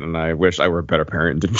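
normal talking voice

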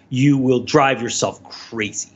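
normal talking voice

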